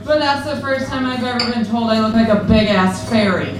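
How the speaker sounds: ordinary talk, nothing marked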